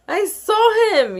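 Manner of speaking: high, loud yell. A narrator's reading. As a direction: yell it out eagerly. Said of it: "yelled Duzit".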